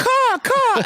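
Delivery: very high squawked caws; comic high bird call; cawing bird. Retold or imitated. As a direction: caw like a bird on a branch. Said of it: "A bird goes".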